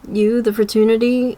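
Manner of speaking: ordinary talk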